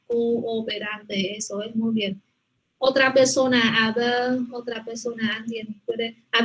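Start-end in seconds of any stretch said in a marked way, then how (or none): 0.1-1.4 "cooperante" slow, the o and final e held; slow syllable by syllable. Shown as a demonstration. Teacher repeats the word slowly after counting syllables. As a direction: stretch "cooperante" into five clear syllables. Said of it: "Cinco sílabas".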